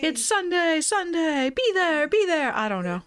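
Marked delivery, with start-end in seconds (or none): 0.0-1.5 high hyped echo of the ad call; monster truck ad. Pretend. 1.5-2.5 monster truck ad. Pretend.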